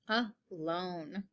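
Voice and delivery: quiet drawn-out "Alone"; hushed model of the long O; soft whispered word. Shown as a demonstration. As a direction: breathe "Alone" very softly. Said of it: "long O sound".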